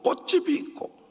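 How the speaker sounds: ordinary delivery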